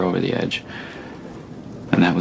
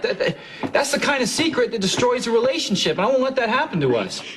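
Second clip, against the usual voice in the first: impassioned plea; 12 semitones higher than usual. Acted. Impassioned plea to his wife.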